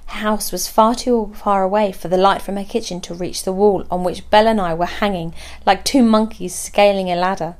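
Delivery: usual speaking voice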